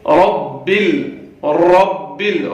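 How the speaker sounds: slow drawn-out heavy ra in رب, mouth full. Shown as a demonstration. Teacher drawing out رب slowly with a heavy ra. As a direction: say رب with a thick, velarised ra. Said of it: "اس کو موٹا کر کے پڑھا جاتا ہے".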